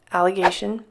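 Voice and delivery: normal talking voice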